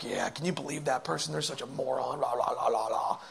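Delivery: low, soft catty aside; hushed, mindless badmouthing; muttered gossip. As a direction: run someone down under your breath, trailing into babble. Acting out giving in to gossip.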